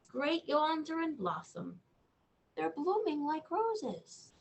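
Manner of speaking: lively storybook reading; reading to children. Acted. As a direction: read the news of the bug to a child. Reads bedtime book aloud.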